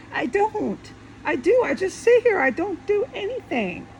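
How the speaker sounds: high-pitched real exasperation; pissed off. Real, not acted. Venting about being stuck doing nothing, pissed off. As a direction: pitch jumps up, fed up and protesting. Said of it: "I'm pissed off".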